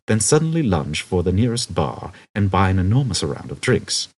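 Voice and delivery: plain reading voice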